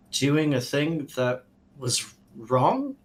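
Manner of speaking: usual speaking voice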